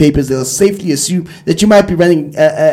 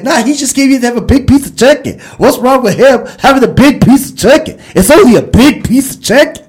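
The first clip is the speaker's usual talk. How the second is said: put-on higher, louder, indignant girlfriend voice; girl from the sketch; 8 semitones higher, 6 dB louder than usual. Girlfriend in a sketch fending off jealous boyfriend, higher indignant voice. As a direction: defend him like she's caught out and brazening it. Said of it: "And she's like".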